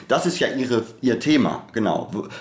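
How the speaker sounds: ordinary delivery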